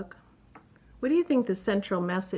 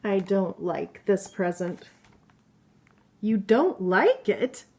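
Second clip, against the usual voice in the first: lively, higher voice for each storybook animal; playful book characters; 3 semitones higher, 4 dB louder than usual. Acted. Mother reading a picture book to her child, lively character voices. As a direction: read the animal friends' squabble with bounce.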